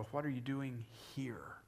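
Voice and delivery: soft question landing on "here"; stress on HERE. Shown as a demonstration. Preacher turning God's question to location, stress on HERE. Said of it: "The question of location".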